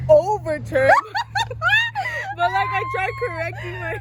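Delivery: high, breaking into real laughter; can't get through it without laughing; laughing while telling. Real, not acted. Telling friend about the crash, real laughter.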